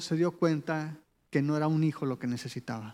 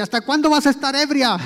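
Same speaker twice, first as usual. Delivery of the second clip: loud, higher sarcastic rebuke; mock tender scolding; 8 semitones higher, 8 dB louder, faster than usual. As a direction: bark it out as a harsh priest, mocking the kindness. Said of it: "va amablemente y con ternura". (reprimenda fuerte y sarcástica)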